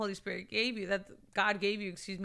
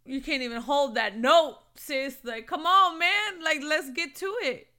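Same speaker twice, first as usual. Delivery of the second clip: loud, higher exhortation; fired-up urging; 4 semitones higher, 10 dB louder than usual. Real, not acted. Woman rallying her sisters to get moving, fired-up urging. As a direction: push them with raised, impassioned energy.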